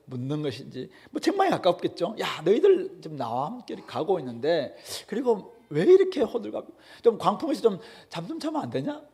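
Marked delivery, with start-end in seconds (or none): 2.2-9.0 exasperated rebuke. Acted. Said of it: "책망하십니다".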